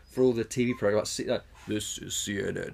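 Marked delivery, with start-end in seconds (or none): 1.7-2.7 mock deep-voiced network ident; lightly put-on announcer voice; James Earl Jones. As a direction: imitate a grand TV announcer. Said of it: "the amount of voice work he does".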